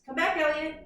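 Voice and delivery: normal talking voice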